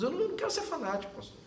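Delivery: high, fast mock protest; Johnny protesting. Pastor imitating Johnny's protest, high and rushed. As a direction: squeak it out in a hurry.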